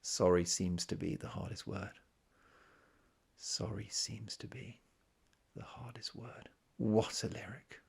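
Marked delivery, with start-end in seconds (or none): 3.4-6.5 breathy hushed whisper, slow and reverent. Shown as a demonstration. Lyric repeated in hushed take. 6.8-7.8 slow hushed whisper. Real, not acted. Whispered admiration of the lyric.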